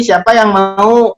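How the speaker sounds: ordinary talk, nothing marked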